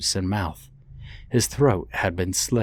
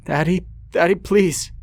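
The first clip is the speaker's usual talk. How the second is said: very high, loud pleading cry; desperate begging; 10 semitones higher, 5 dB louder than usual. Acted. Beaten boy begging his father for mercy. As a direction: beg him to stop.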